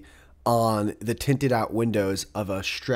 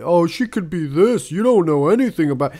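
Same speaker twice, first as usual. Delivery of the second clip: louder mock know-it-all commenter; 5 semitones higher, 4 dB louder than usual. Mocking internet commenters, a louder snide voice.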